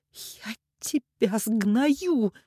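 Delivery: slow, high venomous hiss; hissed threat. A narrator's reading. Mother threatens the man on the phone, hissing. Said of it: "прошипела Ирина".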